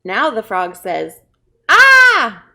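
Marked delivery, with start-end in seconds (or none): 1.7-2.4 high, loud "Aah!"; freaked-out scream. Acted. Frog caught in a jar, freaked-out scream. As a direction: scream in cartoon panic. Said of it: "like freaking out".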